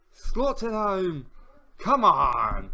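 loud, slow, drawn-out celebration; a loud, triumphant come on; goal cheer. Real, not acted. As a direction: savour the goal and shout it out. His team scores again.

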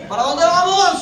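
loud, rousing call to the church; rallying shout. Shown as a demonstration. Preacher rallying the congregation, loud call. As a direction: pump the crowd up with a shout. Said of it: "tranquilo, dígalo".

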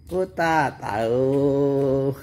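ordinary delivery

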